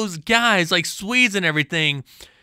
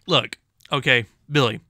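ordinary talk, nothing marked

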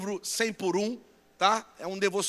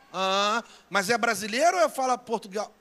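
usual speaking voice

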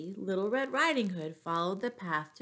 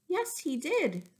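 grandma's voice; 9 semitones higher than usual. Acted. Grandma answers inside belly.

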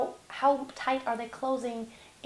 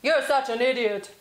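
low yell; 5 dB louder than usual. Shown as a demonstration. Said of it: "tried to yell at someone and just stay down".

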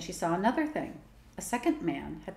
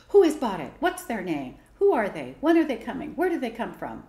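loud, eager barrage of questions; eager rapid questions; 4 semitones higher, 8 dB louder than usual. A narrator's reading. Children bombard father with eager questions. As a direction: pepper him with questions. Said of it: "they all bombarded him with questions".

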